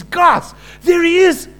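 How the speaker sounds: high, loud shout to his friends; urgent, thrilled proclamation; excited calling out. Acted. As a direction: call out as John spotting the Messiah. John suddenly spots the Messiah.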